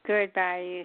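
usual speaking voice

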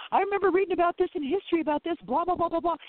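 lightly hammed-up client; imitating a skeptical client. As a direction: parody a client brushing it off as history. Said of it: "they go".

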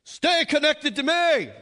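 a whip-cracking religious Jesus laying down the law; mock stern judge roaring a command; harsh condemning Jesus. Shown as a demonstration. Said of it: "seeing Jesus with a whip".

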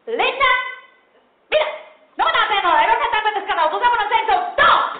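high, loud rapid scolding; screaming mom. Retold or imitated. Mother screaming at her daughter, loud and high. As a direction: yell at the kid in a rush. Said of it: "just start screaming".

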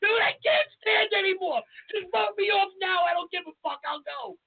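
high, exasperated begging; retold desperate plea. Sweating contestant waiting for elimination, fed-up high plea. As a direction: plead like your knees are buckling.

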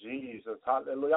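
ordinary talk

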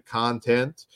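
ordinary talk, nothing marked

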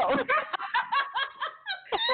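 long high-pitched laugh; real laugh. Real, not acted. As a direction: burst out laughing at your own story. Laughs at her own retort.

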